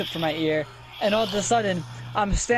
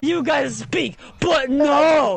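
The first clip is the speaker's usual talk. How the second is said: exasperated shout; 5 semitones higher, slower than usual. Real, not acted. Storyteller complaining friends always interrupt, exasperated shout. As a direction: shout down the people interrupting you.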